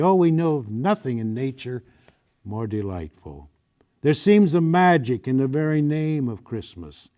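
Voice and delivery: rousing, heightened read-aloud of a Victorian passage; declamatory reading. Acted. Reading a Christmas passage to an audience, with rousing declamation. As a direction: preach the good cheer of Christmas to the room.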